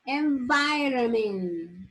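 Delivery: each word stretched out slowly; slow pronunciation drill; slow model words. Shown as a demonstration. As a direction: drill them slowly for the class. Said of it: "teacher models word list".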